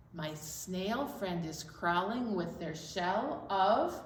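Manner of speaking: ordinary talk, nothing marked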